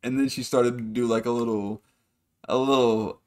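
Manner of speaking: ordinary talk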